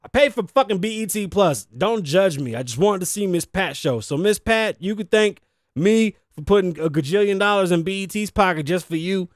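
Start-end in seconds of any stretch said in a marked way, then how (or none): none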